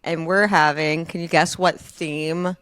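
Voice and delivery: ordinary talk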